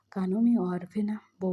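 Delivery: plain reading voice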